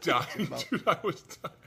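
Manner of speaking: high laughing voice; recalling the funniest moment, laughing. Real, not acted. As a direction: say it through laughter. Laughing at the memory.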